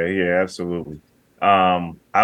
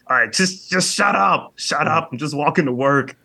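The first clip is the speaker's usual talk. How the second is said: irritated, higher "shut up, shut up"; annoyed grumble; 5 semitones higher than usual. Retold or imitated. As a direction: snap at the singing sun. Imagined annoyed grown man.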